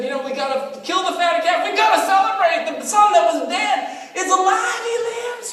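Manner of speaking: high, loud jubilant call; joyful father. Retold or imitated. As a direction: cry out for a celebration. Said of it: "and he said".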